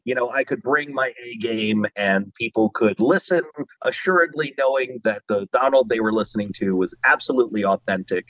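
ordinary talk, nothing marked

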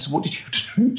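dad fishing for what she drank, sweet high probe; mock innocent question. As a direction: ask sweetly, fishing for the answer. Dad asking daughter about drinks.

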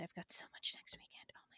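whispered aside. Real, not acted. Overwhelmed aside.

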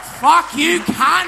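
shouted through laughter, high and loud; laughing yell at the guy; laughing mock shout. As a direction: yell it while cracking up. Mock-yelling at the Uber passenger.

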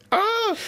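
high-pitched "Ah!"; mock exclamation. Recalling his reaction to the match, high mock cry. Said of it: "I was just like".

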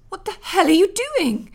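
high, loud angry snap; furious snap; snapped. Acted. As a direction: bark it at the handyman. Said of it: "she snapped".

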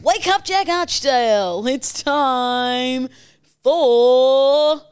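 hammed-up radio host intro; show intro announcer. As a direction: announce the show like a big broadcast. Host's mock show intro.

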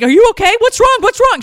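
imitating a frantic friend, high and loud; panicked friend. Friend Tracy panics seeing [name] bawl, imitated high frantic questioning. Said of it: "She started panicking".

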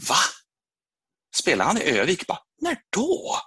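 high-pitched mock disbelief; acted-out incredulous questions; retold astonishment. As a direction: question it in surprise, jumping up high. Acts out his surprised reaction.

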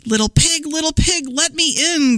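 high, sing-song mock wolf growl; a hint of hungry wolf calling at the door; lightly growled wolf. A narrator's reading. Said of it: "growled the wolf".

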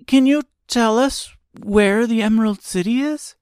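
put-on high girl voice, asking for help; Dorothy as a figure.

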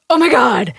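loud, crying shout of horror; panicked shout. Retold or imitated. Husband seeing red and thinking he cut his wife, panicked shout. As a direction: yell as if you just saw blood.

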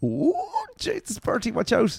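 high, startled warning; mock alarm. Mock alarm at stealing a pen.

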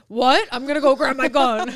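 loud, high, hammed-up indignation; mock outrage. Imitating co-host going for the gun, mock outrage. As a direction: blurt it out as if offended, laugh close behind. Acting out an offended person's reaction.

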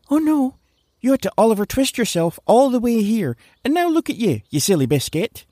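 high, mocking self-scolding. Larry mocking himself for coming here, high mock scold. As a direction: tut at yourself in a high mock voice.

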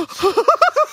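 high squealing laugh of disgust. Real, not acted. High squealing laugh while friends describe the toothpick. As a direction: squeal with laughter at the gross idea.

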